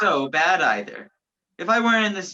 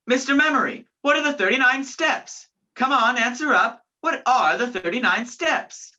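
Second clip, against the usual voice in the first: high-pitched public challenge; insistent calling out; 5 semitones higher than usual. Acted. Man challenging Mister Memory about the steps, insistent call. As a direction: demand an answer across the theatre. Calls question to the stage.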